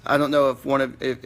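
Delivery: ordinary talk, nothing marked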